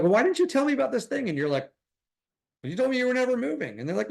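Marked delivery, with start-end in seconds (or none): 0.0-1.3 high mock friend voice. Agent friend asks, voiced high. 2.6-3.8 high mock reply. Retort to friend, voiced high.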